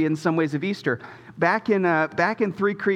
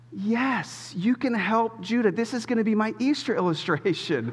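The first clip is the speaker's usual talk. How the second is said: delighted dad; 5 semitones higher than usual. Retold or imitated. Excited dad answering.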